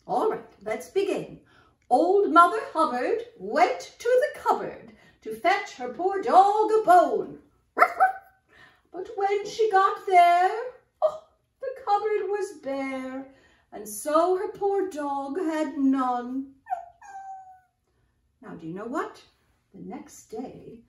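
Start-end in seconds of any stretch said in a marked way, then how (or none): 1.9-7.4 put-on loud, sing-song reading to kids; nursery rhyme recitation. Storyteller reciting Old King Cole to children, loud and sing-song. 7.8-8.2 very high, loud ruff-ruffs; dog barking. Acted. Storyteller voicing the dog in the rhyme, high barks. 8.9-16.5 put-on loud, sing-song reading to kids; nursery rhyme recitation. Storyteller reciting Old King Cole to children, loud and sing-song.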